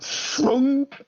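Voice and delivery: sharp high thunk of a bullet into a wall. Acted. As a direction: spit out the impact sound. GM sound effect of bullet hitting.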